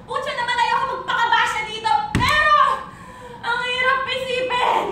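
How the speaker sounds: high-pitched exasperated lament; anguished outburst. Acted. Bitter breakup monologue, outburst. (pasigaw na hinanakit)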